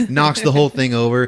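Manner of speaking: ordinary delivery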